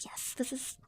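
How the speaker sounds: usual speaking voice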